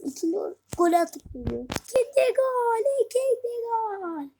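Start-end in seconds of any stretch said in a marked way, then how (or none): 1.7-4.3 high, slow chanted goal shout; jubilant shout. Real, not acted. Gamer celebrating a second goal, jubilant drawn-out shout. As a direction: cry out the second goal, drawing it out.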